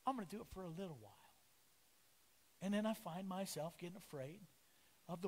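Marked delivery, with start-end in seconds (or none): 0.1-1.1 soft, hushed self-pep-talk, speeding up; quiet resolve. Retold or imitated. Preacher voicing his own half-hearted resolve, quiet. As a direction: mutter a brave promise to yourself. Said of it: "And I'm like".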